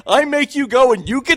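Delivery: said through a laugh, high and loud; laughing ribbing. Real, not acted. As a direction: laugh the words out while teasing. Laughing joke about the job.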